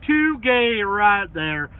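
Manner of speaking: high loud slow mock-announcer line; hammed-up hype. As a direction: stretch it out like a big announcement.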